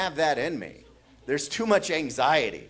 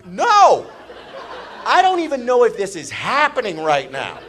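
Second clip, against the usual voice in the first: high, loud mock panic; exasperated loud cry; panicked outburst; 6 semitones higher, 10 dB louder than usual. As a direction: shout it in acted disbelief. Acts out panicked thought.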